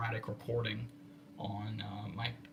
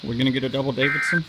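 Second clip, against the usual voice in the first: excited question; 11 dB louder, faster than usual. Real, not acted. Reacting to the radio alert.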